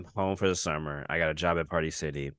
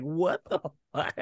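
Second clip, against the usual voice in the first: higher mock outraged mom; imitating the mom; 9 semitones higher than usual. Friend voicing the mom, higher mock outrage. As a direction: play the shocked mom.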